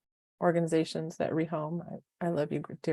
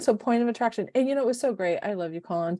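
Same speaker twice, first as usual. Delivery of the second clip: ordinary delivery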